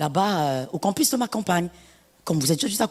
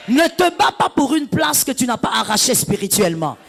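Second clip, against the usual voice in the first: preaching at full force; 4 semitones higher, 4 dB louder than usual. Acted. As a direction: hammer each word from the pulpit. Preaching climax.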